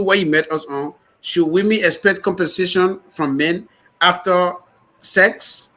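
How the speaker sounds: ordinary delivery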